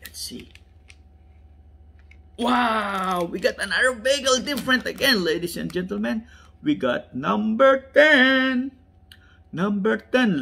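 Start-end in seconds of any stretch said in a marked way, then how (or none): 2.4-10.4 high, loud, thrilled announcement; wowed, raised-voice excitement; excited reveal. Real, not acted. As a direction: announce it like a winning number. Revealing a different car.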